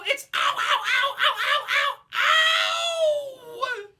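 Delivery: high, very loud yelps of pain; shrieking ow. Acted. Bitten child crying out to Mummy, high shrieks. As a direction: scream as it bites.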